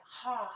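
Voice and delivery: loud open-mouthed sigh out the mouth; big sigh. Shown as a demonstration. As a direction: let out a big audible exhale. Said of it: "like a big sigh".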